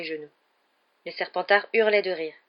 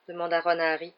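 plain reading voice

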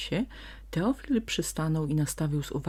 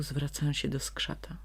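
ordinary delivery, nothing marked

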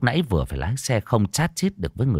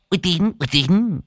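laughing boast; 10 semitones higher, slower than usual. Acted. Old man laughing, boasting.

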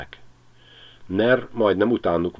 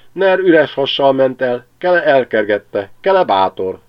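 vidám kacsák; 7 semitones higher, 8 dB louder than usual. A narrator's reading. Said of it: "vidámkodtak a kacsák".

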